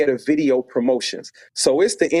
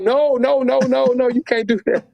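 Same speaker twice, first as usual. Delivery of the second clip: panicked refusal; 10 semitones higher than usual. Retold or imitated. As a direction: beg her to stop before it's too late. Panicked, afraid of losing job.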